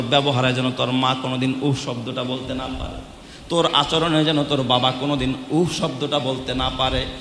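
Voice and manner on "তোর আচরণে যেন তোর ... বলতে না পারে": usual speaking voice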